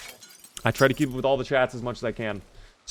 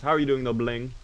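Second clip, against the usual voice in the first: normal talking voice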